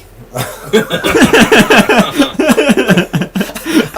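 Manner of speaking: long rising real laugh, loud. Real, not acted. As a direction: burst out laughing at your own joke. Laughs at own foggy joke.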